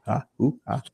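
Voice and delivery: building up to a sneeze; catching breath before sneezing; pre-sneeze gasps. Real, not acted. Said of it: "I'm going to sneeze now".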